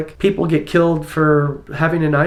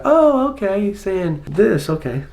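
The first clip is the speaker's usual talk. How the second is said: dawning realization; 5 semitones higher than usual. Retold or imitated. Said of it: "you go".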